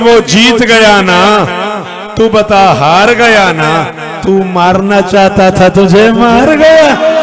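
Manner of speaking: very loud, slow, drawn-out declamation; full-force recitation. Acted. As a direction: hurl the taunting verse out, climbing at the end.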